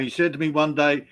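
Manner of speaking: usual speaking voice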